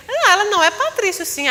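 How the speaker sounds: high, loud, rushed frightened denial; scared insistence. Retold or imitated. Manicurist afraid, insisting on the wrong name, high and rushed. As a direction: blurt it out nervously, pitch up. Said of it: "Aí ela com medo".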